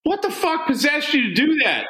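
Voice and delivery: incredulous outburst. Real, not acted. Friend asking why he did it, high incredulous shout. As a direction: demand an answer in disbelief.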